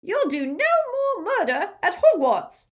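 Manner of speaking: a tiny wizard squealing defiance; squealing Flitwick. Acted. Flitwick shouting at Snape, high squeal. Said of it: "squealed Flitwick".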